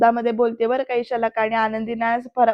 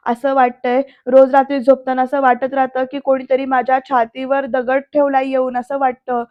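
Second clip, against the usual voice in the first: ordinary talk, nothing marked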